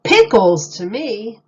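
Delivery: a booming punchline; loud reveal. A narrator's reading. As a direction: shout the answer triumphantly.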